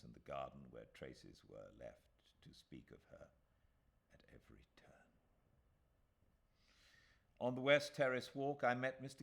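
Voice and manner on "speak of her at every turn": fading whisper. A narrator's reading. Narrator remembering her, fading to a whisper. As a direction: let it fade to a whisper.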